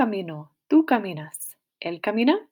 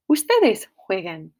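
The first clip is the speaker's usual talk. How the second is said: ordinary talk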